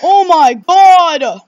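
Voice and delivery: loud, slow, drawn-out exclamation; real horrified outcry; shocked horror. Real, not acted. Horrified reaction to game.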